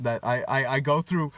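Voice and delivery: normal talking voice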